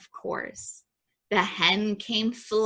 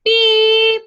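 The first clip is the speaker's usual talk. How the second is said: high, loud toy horn beep; one held, high-pitched beep; 14 semitones higher, 10 dB louder than usual. A narrator's reading. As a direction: beep like a cheerful little truck horn. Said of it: "Horn went".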